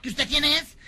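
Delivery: high, loud comic challenge; insistent demand. Acted. Marciano insiste por última vez. (exigiendo respuesta a gritos)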